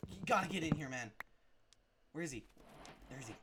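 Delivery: usual speaking voice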